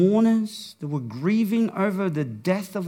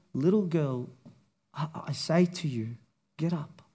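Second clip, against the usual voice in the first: put-on Jesus gently waking the girl, hushed and tender; 5 semitones lower, 9 dB quieter than usual. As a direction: lower and softer, gently coaxing. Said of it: "with that tender voice".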